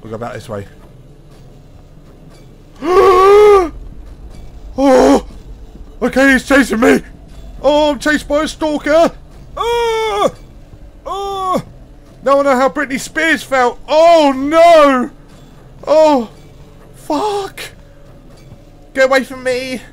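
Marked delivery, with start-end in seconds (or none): 0.7-4.7 loud high gasp of fright; terrified gasp. Real, not acted. Gamer seeing the stalker appear, terrified gasp. 4.8-19.8 high, loud frantic yelling in fear; panicked yelling. Real, not acted. Gamer fleeing the stalker in the game, panicked high yells. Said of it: "I'm panicking now".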